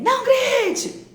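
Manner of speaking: very high, loud, slow yell; shouting don't shout. Shown as a demonstration. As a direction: scream the command at the child. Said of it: "Mas você tá gritando".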